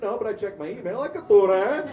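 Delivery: high, loud comic comeback; mock cocky boast. Actor mocking stranger's boast about easy jobs, high cocky imitation.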